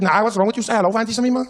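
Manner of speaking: high, pushy Cape auntie; odd Cape woman. Retold or imitated. Woman nags a shell-shocked stranger to greet her, pushy. Acting the woman accosting him.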